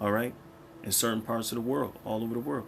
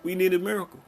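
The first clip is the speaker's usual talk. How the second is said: fervent cry; 10 semitones higher, 5 dB louder, faster than usual. Real, not acted. Preacher rising to a peak.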